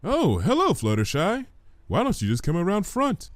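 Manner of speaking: chirpy surprised vendor greeting; lightly voiced vendor.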